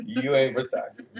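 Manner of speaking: laughing through the words, slowing down. Real, not acted. Repeating the Stockton roast to a friend, laughing. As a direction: crack up while repeating the taunt.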